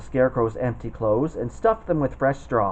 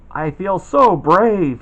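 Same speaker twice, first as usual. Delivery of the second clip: proud cry; 5 semitones higher, 8 dB louder, much slower than usual. Acted. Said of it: "he cried".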